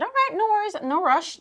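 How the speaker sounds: high-pitched, over-polite reassurance; placating politeness. Retold or imitated. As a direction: go high and sweet to smooth things over. Retold placating reply to rude attendant.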